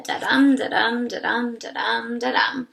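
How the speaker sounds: sing-song da-DUM, stress on dum; chanted iambic beat; da-dum rhythm. Shown as a demonstration. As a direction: tap out da-dum da-dum, weak then strong. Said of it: "we looked at iambic pentameter".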